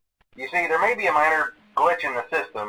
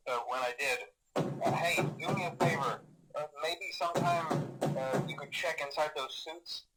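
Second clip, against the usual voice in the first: quiet, hesitant phone message, trailing into pauses; uneasy, halting; 12 dB quieter than usual. Acted. Night guard leaving a last message on a bad night, uneasy. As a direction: hold back fear and keep it casual, softly.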